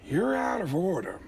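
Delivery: high, drawn-out movie-star outburst impression; Al Pacino. Actor ranting among broken washers, escalating impression. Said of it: "cut to me as Al Pacino".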